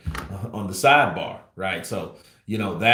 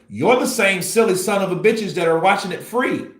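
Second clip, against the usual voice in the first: normal talking voice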